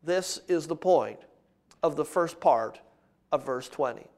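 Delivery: low, hushed, deliberate pulpit delivery; measured sermon opening. Real, not acted. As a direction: open the sermon softly, pausing between phrases. Quiet summing up in sermon.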